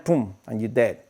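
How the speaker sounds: ordinary delivery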